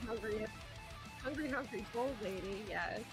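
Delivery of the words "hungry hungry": ordinary talk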